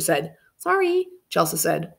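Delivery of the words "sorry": high small apology; sheepish child. A narrator's reading. Scolded girl apologizing to the storyteller, high sheepish sorry. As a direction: pipe a little sorry.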